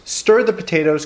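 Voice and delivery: usual speaking voice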